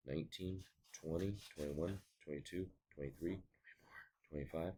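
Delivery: soft whispered counting; hushed counting while working; counting under breath. Real, not acted. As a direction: count quietly to yourself, placing each number. Counting minutes out quietly under breath.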